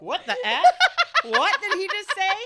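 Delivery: high, loud retold shock; loud, high-pitched incredulous outburst; shocked disbelief. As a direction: blurt it out in stunned disbelief. Retold shock at bad news.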